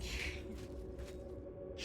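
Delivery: soft, breathy wheeze of laughter; laughing. Real, not acted. Player cracks up trying to type a word, wheezy laugh. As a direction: laugh almost silently.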